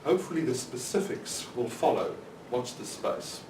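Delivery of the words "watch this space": ordinary delivery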